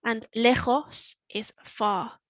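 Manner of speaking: slow, clear "Cerca" and "lejos"; slow teaching pace. Shown as a demonstration. Teacher repeating vocabulary, slow and clear. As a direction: lay out each word slowly. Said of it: "It's easy to forget little words".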